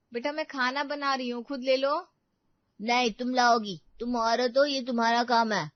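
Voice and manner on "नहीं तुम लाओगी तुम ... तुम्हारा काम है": loud, stubborn insisting; bossy command. Acted. Spoiled son tells his mother it's her job, loud and bossy. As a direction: lay down the rule like a little king.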